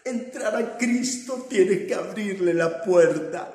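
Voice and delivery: loud, slow, rising preacher's proclamation; preaching at full force. Acted. Preacher proclaiming God's power to the congregation, full force. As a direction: drive each phrase home to the church.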